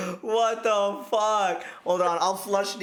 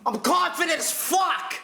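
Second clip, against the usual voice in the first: defensive shout; 9 semitones higher than usual. Real, not acted. Defensive denial.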